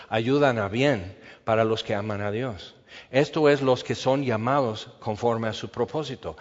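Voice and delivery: ordinary talk, nothing marked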